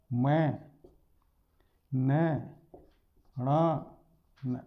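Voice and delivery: teacher demonstrating nasal letters; nasal letters demonstrated. Shown as a demonstration. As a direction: sound out न and ण through the nose, one by one. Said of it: "जैसे यह बोल के देख लीजिए आप".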